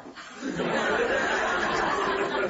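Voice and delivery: breathy laugh; amused laugh. Real, not acted. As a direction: laugh at own joke. Audience laughter.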